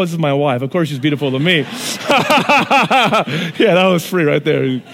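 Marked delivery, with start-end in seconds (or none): 1.6-3.5 hearty laugh; audience-facing laugh. Real, not acted. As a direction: laugh at your own joke. Laugh after joke about wife.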